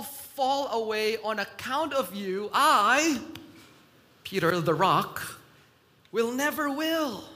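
loud, emphatic acted vow; proud declaration. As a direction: declare it boldly, pausing for weight. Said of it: "He proudly expressed his love for Jesus".

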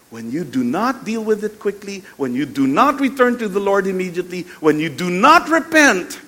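preacher building to a loud peak on each refrain; mounting sermon warning; emphatic preaching. Acted. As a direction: raise pitch and force clause by clause.

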